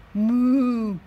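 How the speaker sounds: put-on playful lowing for the kids; cow moo. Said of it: "said Cow".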